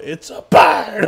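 high, loud, drawn-out yell; hammed-up astonished announcement; excited shout. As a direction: holler it as the overexcited bystander. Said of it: "why was he so excited to shout".

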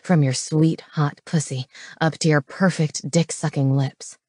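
low, possessive growl; low-pitched dominant lover; growling male lover. Acted. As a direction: keep the man's voice low and claiming. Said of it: "he growled".

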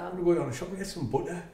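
quick, soft Teesside accent; soft Middlesbrough accent landing on "butter". Shown as a demonstration. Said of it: "the Middlesbrough accent".

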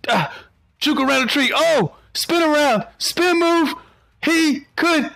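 high, excited play-by-play; excited dodging. Real, not acted. Player juking the creature round a tree, excited. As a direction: call the moves out high and giddy.

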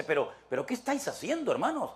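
Paul angrily setting them straight; irritated Paul. Retold or imitated. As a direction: scold the quarrelling church. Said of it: "Pablo se enoja y dice".

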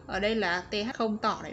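normal talking voice

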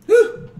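loud high whew after a hit; high exhale. Real, not acted. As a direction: blow out the smoke.

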